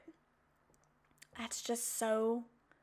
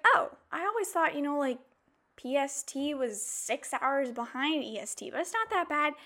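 higher, breezy naive voice; naive past self; 3 semitones higher than usual. Retold or imitated. Vlogger mimicking her old naive self about time zones, breezy. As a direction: sound carelessly sure of yourself. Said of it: "I was like".

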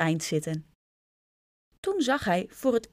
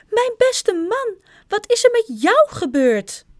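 shouting; 8 dB louder than usual. A narrator's reading. To a husband who suddenly has wings, shouting. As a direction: shriek it in alarm, stretching the first words. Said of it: "schreeuwde meneer Krijtjes".

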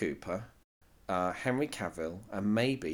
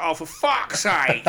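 mock exasperation; 6 semitones higher, 6 dB louder, slower than usual. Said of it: "It's like".

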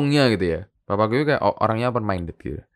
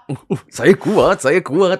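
high, drawn-out mock bragging; puffed-up parody of bragging; mock boasting; 8 semitones higher, 7 dB louder, much slower than usual. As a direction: play a cocky show-off, loud and high. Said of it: "sombong akan dirimu kuat".

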